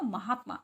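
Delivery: महात्मा as "ma-haat-maa"; no vowel after the t in महात्मा; correct pronunciation. Shown as a demonstration. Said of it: "गलत है ये". (महात्मा, आधे त के साथ)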